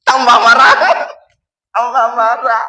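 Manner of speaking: loud, exasperated burst on "marah"; angrier wife. Pretend. Wife getting angrier when husband quotes the hadith, loud burst. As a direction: blast back like a wife even angrier. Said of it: "kalau sekarang ibu-ibu tiba-tiba marah".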